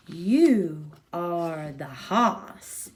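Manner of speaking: put-on very slow bossy boy voice; bossy kid. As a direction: drag it out like a little boss. Kid orders Bill.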